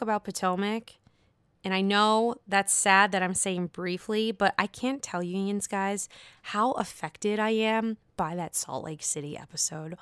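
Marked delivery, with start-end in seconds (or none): 6.4-10.0 whispered, breathless confession. Real, not acted. Host confides to listeners how shaken he still is, whispering. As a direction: let it slip out in a whisper.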